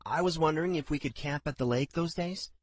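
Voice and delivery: a hint of a hesitant daughter; young woman voice. A narrator's reading.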